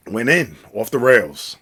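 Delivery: normal talking voice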